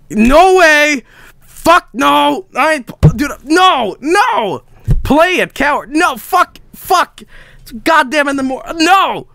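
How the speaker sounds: high, agitated protests; panicked refusal. Real, not acted. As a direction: refuse in rising panic, bursts of no. Refusing scary game.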